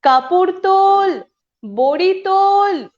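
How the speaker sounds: high, loud, drawn-out call; performed housewife's urgent holler. Acted. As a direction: holler orders across the house, stretching each word. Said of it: "ঘন হাঁকে".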